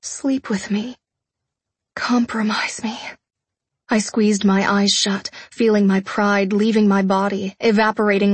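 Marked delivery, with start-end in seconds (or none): 0.0-3.0 soft, breath-laden plea; broken whisper. A narrator's reading. Fiancée pleading with her fiancé, whispering brokenly. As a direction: beg quietly, pride giving way. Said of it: "I whispered brokenly".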